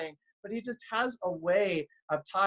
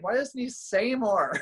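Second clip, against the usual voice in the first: exasperated; 5 semitones higher than usual. Retold or imitated. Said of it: "I'm like".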